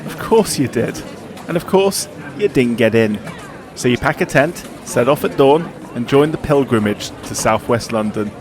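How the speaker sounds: dramatic scripted intro read over music; documentary narration. Acted. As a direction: read it like a sports documentary voiceover. Scripted podcast cold open.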